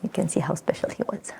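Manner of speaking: soft tender whisper. Acted. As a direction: breathe it out lovingly. Tender memory of Dave.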